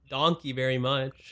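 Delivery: slow, exaggerated American accent; deliberately American-accented mispronunciation; mega American accent. Shown as a demonstration. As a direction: stretch out the German word like an American. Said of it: "with the Omega American accent".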